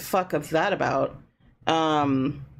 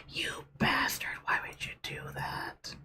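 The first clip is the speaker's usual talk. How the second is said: whispering; 10 dB quieter than usual. Real, not acted. Whispered aside.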